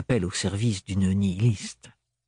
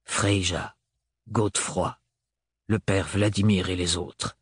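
avec lenteur; 4 semitones lower than usual. A narrator's reading. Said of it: "dit-il avec lenteur".

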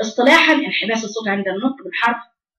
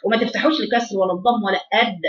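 ordinary talk

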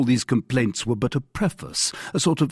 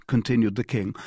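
plain reading voice

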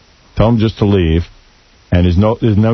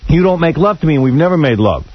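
mock scream; 6 semitones higher than usual. Sister screaming at her brother on air, high-pitched mock scream. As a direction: shriek her words up high like she's losing it. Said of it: "she's screaming at him".

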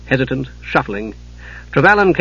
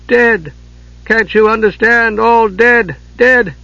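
much higher, loud anguished cries; anguished shouting; 9 semitones higher, 3 dB louder, much slower than usual. Acted. Broken commander shouting the deaths at his men, anguished. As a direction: scream the news at them in despair.

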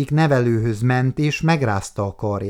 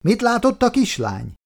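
called out; 11 semitones higher than usual. A narrator's reading. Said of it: "buszvezető oda kiáltott".

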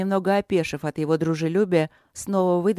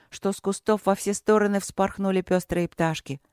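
usual speaking voice

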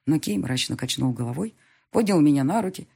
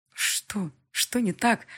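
worried question; 5 semitones higher, much slower than usual. A narrator's reading. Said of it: "Обеспокоенно коснулась".

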